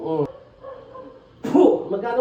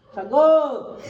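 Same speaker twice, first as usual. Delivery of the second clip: loud, raised-pitch command; forceful interrogator's shout; sharp demand; 4 semitones higher, 5 dB louder than usual. Real, not acted. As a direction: order them to answer at once. Healer demanding answers from possessed patient.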